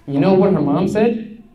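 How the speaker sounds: ordinary talk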